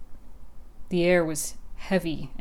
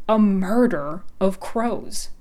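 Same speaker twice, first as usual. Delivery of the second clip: slow, louder scandalized reveal; scandalous tone; 3 semitones higher, 5 dB louder, slower than usual. Acted. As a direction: savor the shocking words. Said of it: "in a scandalous tone".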